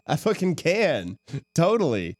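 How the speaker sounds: ordinary talk, nothing marked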